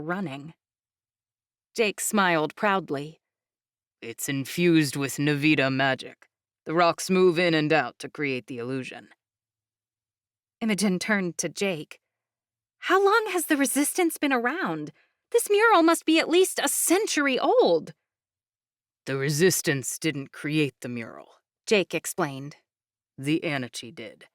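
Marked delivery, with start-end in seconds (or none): 4.0-9.1 low, calm proud explainer voice; proud young man. Acted. Jake character voice. 12.8-17.9 high, eager questioning voice; curious young woman. Acted. Imogen character voice. 19.1-21.4 low, calm proud explainer voice; proud young man. Acted. Jake explains. 23.2-24.3 low, calm proud explainer voice; proud young man. Acted. Jake explains.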